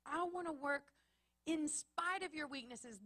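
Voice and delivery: voicing God's delight, building from gentle to exultant. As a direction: let God's words rise to a triumphant peak. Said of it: "what he wants to say to us is".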